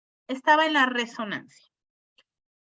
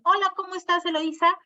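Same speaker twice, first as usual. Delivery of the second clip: caller greeting on the phone; 8 semitones higher, 3 dB louder than usual. Retold or imitated. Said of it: "contestamos el teléfono y nos dicen".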